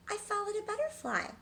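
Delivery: lamb voice. A narrator's reading.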